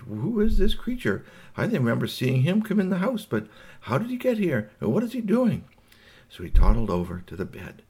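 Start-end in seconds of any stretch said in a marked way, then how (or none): 0.0-5.6 high-pitched wondering questions; intrigued toddler. Acted. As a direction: puzzle over it in a much higher voice. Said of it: "Buster was very intrigued".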